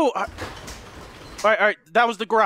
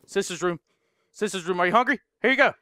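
ordinary talk, nothing marked

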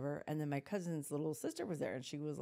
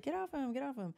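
high-pitched, unraised mock scolding; lightly voiced urgent command; aunt's retold order; 8 semitones higher than usual. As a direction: imitate an aunt breaking up a fight. Retold aunt breaking up a fight.

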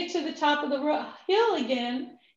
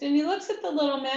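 usual speaking voice

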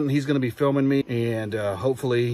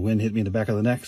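usual speaking voice

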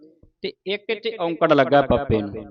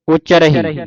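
ordinary talk, nothing marked

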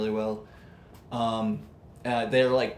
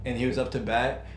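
usual speaking voice

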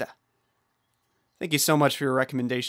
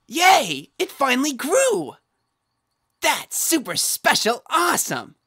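excited squeal; 10 semitones higher, 4 dB louder than usual. Real, not acted. Thing finally grew.

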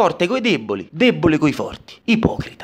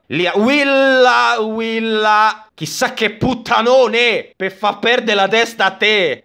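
ordinary delivery

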